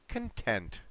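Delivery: "content" with weak "con" and strong TENT; stress model. Shown as a demonstration. Teacher has student try again, "content" stressed con-TENT. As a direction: stress the second syllable of "content". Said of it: "try this again one more time".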